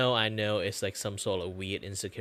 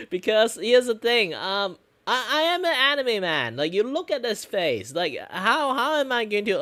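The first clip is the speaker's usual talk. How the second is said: much higher and louder, youthful; 13 semitones higher, 10 dB louder than usual. Real, not acted. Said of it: "I pitch high my voice to sound more young".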